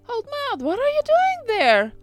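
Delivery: put-on very high girlish character voice; curious princess. A narrator's reading. Princess asks the old maid what she does, curious. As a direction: call it out high, rising to loud.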